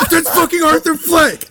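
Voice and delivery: high-pitched outraged yell, imitated; mock yelling. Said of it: "Avery starts yelling".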